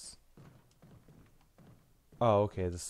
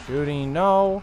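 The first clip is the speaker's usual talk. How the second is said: loud, raised cry on the no; alarmed shout; 6 semitones higher, 11 dB louder, slower than usual. Real, not acted. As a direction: burst out in protest. Alarmed in a gunfight.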